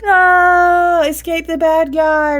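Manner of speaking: high, loud, breathless movie heroine; hammed-up action-movie escape cry. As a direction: shriek like a hero fleeing the villain. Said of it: "She's like".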